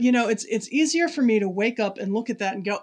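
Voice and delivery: ordinary talk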